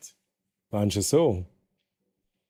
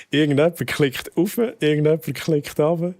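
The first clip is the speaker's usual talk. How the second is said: higher, louder amused narration; excited; 6 semitones higher, 4 dB louder than usual. Real, not acted. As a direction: gleefully report the clicks. Amused commentary.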